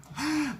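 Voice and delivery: sharp, high acted gasp; mock shocked gasp. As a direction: gasp as if spotting the culprit. Acting out Harry spotting the culprit.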